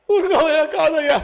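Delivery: high, loud crying protest; tearful brush-off. Retold or imitated. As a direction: wave him off through sobs. Mourner brushes off the questioner. (ఏడుస్తూ విసుగ్గా కాదనడం)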